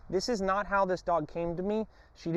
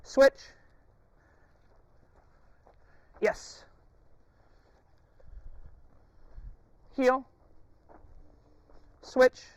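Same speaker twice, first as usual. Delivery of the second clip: sharp command; 8 semitones higher, much slower than usual. Real, not acted. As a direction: snap the command out. Commands to dog.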